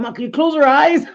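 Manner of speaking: put-on high, loud, pleading kid voice; scared kids begging their mom to close the doll's eyes; pleading sons. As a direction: beg mom like a spooked child. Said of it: "They're like".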